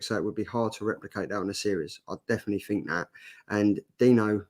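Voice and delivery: ordinary delivery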